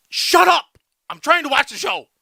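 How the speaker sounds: high, sharp shut up, retold; mock snap. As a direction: snap shut up at the noisy room. Retold snapping at room.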